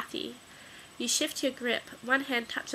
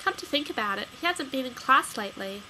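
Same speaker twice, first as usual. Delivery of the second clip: ordinary delivery, nothing marked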